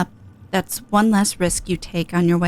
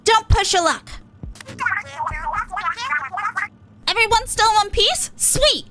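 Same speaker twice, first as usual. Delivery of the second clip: higher, brash little idol voice; cocky and upbeat, pitched up; Pearl voice; 13 semitones higher than usual. Acted. Streamer voicing Pearl to Eight, pitched up and cocky.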